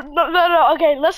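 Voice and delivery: usual speaking voice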